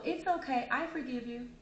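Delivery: high, forgiving victim voice; too-easy forgiver. Acted. Victim answering the attacker's apology, forgiving. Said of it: "And then you go".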